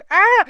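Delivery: a high, loud elf's wail of alarm; moan. A narrator's reading. Dobby hearing the dark name, high loud moaning cry. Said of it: "and moaned".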